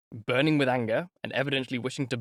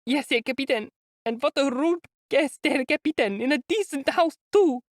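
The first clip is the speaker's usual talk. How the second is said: put-on high German-accented complaint; indignant German landlady; 13 semitones higher than usual. A narrator's reading. Landlady complaining to the lieutenant in German accent. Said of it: "in a strong German accent".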